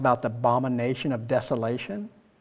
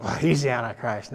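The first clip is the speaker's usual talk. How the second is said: mock guesser. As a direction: toss it off like gossip. Mimicking people guessing the Antichrist.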